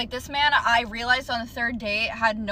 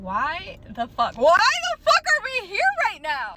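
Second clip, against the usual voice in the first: higher, louder, slower outraged cry; outraged disbelief; 8 semitones higher, 6 dB louder, slower than usual. Real, not acted. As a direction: let the disbelief explode. Outraged at scared boyfriend.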